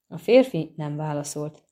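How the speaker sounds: ordinary delivery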